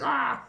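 high-pitched "ah" of pain; mock pain yelp. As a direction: yelp as if your arm snapped. Acting out arm being snapped.

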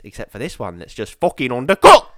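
low start bursting into a shout on undercooked; screaming at a pudding. Acted. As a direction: lose it on the last word. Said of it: "he's just there screaming".

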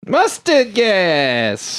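high, very slow mock alarm call; drawn-out battle cry. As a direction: stretch it out like a buzzer cry. Buzzing in with a catchphrase.

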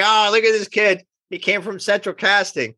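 a mock gush at the new kid; mocking Jim. Jim ribbing the kid in the suit, high teasing voice. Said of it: "He's like".

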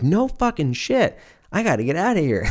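acted-out excited coworker; mock amazement. Acts out coworker's thrilled reply.

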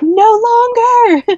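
very high, loud, slow triumphant cry; joyful scream. Retold or imitated. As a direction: fling it out with all your joy. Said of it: "I just kept screaming".

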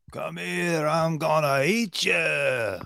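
high, drawn-out playful monster voice; hammed-up menace, stretching out the last words; mock cannibal. Jokingly plays the cannibal at camera.

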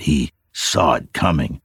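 plain reading voice

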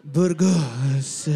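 low mock Spanish accent; put-on Spanish accent. Shown as a demonstration. Quiz host hinting at Spain, mock Spanish accent. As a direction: call it out in hammed-up Spanish. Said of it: "I would probably say with an accent".

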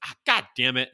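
loud mock yell of frustration; quoted shout. Acting out the character's outburst for his friend, loud. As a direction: belt it out as the fed-up character. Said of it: "And he's like".

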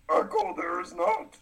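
high, inverted Yoda phrasing; Yoda impression. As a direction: speak as the tiny green master. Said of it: "fun to talk like Yoda".

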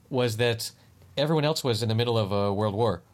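ordinary talk

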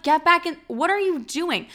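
panicked plea. Retold or imitated. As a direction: yell at him to get back inside, scared. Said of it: "I'm like".

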